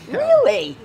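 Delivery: high, loud sarcastic disbelief; mocking real disbelief; incredulous. Real, not acted. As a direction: scoff at the claim. Confronted by three security guards.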